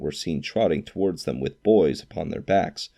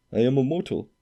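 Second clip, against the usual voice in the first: put-on higher, protesting old man; old Scrooge pleading; 7 semitones higher, faster than usual. A narrator's reading. Scrooge protests he is mortal to the ghost, pleading. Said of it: "Scrooge remonstrated".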